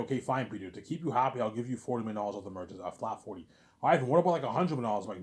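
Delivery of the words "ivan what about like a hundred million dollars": louder, higher mock haggling; pushy demand. Peter haggles with Ivan for more money, pushy demand. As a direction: push for more money, pitched up.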